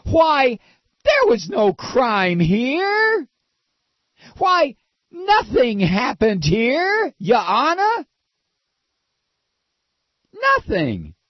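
high, drawn-out whiny protest; hammed-up wail of feigned innocence; slow, high-pitched mock outrage; mock screaming. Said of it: "is screaming".

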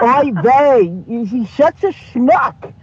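put-on slow, sighing Yiddish accent. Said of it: "Do you do a Yiddish accent".

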